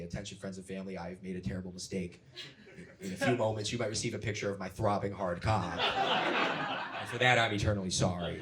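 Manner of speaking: quiet, grave public apology; solemn announcement. Retold or imitated. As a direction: read it like a somber statement. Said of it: "they'll say".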